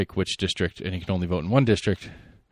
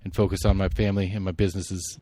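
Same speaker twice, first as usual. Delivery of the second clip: ordinary talk, nothing marked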